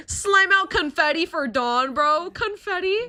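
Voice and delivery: loud, high cheer; excited cheer. Real, not acted. To chat, a loud high cheer. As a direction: hype the donation.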